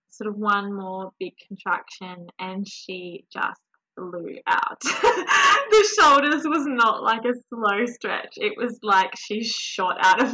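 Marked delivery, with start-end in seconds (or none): 4.9-5.6 high crying laugh; a sob-laugh of relief; laughing through tears; tearful laugh. Real, not acted. Baby just born.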